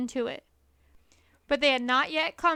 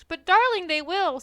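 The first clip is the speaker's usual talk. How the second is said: sobbing; 5 semitones higher, 8 dB louder than usual. A narrator's reading. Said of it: "sobbed Missus Fox".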